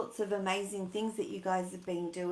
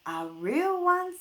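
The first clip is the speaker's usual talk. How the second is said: a hint of a higher mummy voice, surprised; Mummy's surprised question; 11 semitones higher, 8 dB louder, slower than usual. Mother surprised at the real stage, lightly voiced. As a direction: lift it up like an amazed mum. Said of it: "said Mummy".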